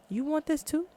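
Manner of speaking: high-pitched, rising disbelief; incredulous question. Acted.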